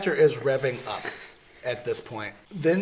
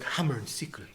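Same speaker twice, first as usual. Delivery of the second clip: softer, badly faked Russian accent; 7 dB quieter than usual. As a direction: attempt a Russian accent under your breath. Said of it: "Is that your Russian accent?".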